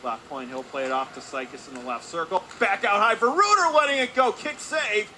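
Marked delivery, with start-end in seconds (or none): 2.6-5.1 excited shot call. Real, not acted. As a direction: voice leaps high and loud.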